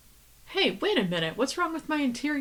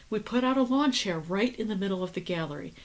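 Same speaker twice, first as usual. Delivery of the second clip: normal talking voice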